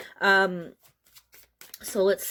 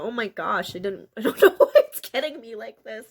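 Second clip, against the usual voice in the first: normal talking voice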